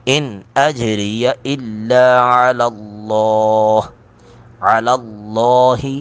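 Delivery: اللہ with the لام full and heavy; heavy lam. Shown as a demonstration. Teacher showing a heavy lam in Allah, slow recitation. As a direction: recite الا علی اللہ slowly, lam thick and full. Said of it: "لام کو پر کر کے پڑھیں گے".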